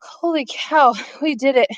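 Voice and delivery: ordinary talk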